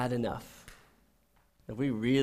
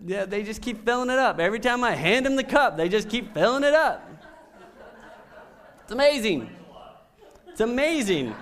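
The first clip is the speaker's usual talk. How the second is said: higher and louder, marvelling; excited; 8 semitones higher, 4 dB louder than usual. Real, not acted. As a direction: sell how amazing the free refills are. Excited refills, amazing.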